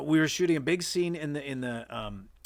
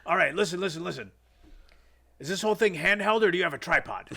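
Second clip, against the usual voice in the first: loud, raised-pitch impatient demand; imitated yeller; 6 semitones higher, 6 dB louder, faster than usual. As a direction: play the shouty director cutting in. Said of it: "this guy yells a lot".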